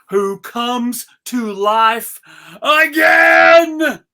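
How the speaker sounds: slow build, bursting into a shout on again; stretched-out booming reveal; dramatic shout. A narrator's reading. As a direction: announce the miracle at full volume. Frosty comes to life, big reveal.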